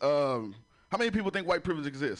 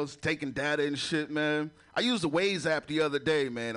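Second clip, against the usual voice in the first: ordinary talk, nothing marked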